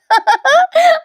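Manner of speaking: sudden high laugh; high burst of laughter. Real, not acted. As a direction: break into real laughter. Laughs at the scene. (मोठ्याने हसणे)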